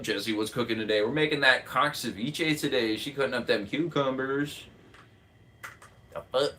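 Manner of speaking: ordinary talk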